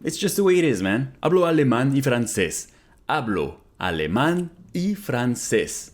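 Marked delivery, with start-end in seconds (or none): 3.1-5.9 slow, careful, clearly spaced words; slow model repeat. Shown as a demonstration. Teacher repeating Spanish for students, slow and careful. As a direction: say it slowly for learners to copy. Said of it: "slow repeat of model".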